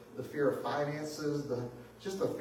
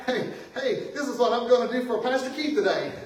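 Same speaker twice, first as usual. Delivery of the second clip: playful voice of God; 8 semitones higher, 7 dB louder than usual. Pretend. God's playful letter voiced.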